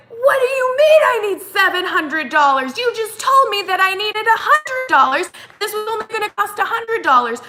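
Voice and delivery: high, loud, indignant re-enacted yell; retold angry yelling. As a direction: yell it back like an outraged customer. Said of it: "I end up yelling at him".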